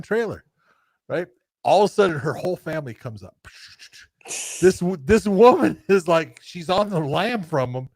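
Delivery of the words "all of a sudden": ordinary delivery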